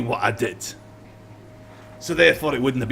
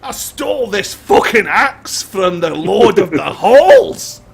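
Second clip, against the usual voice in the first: boastful; 5 semitones higher, 7 dB louder, slower than usual. Acted. Prisoner boasts under truth spell.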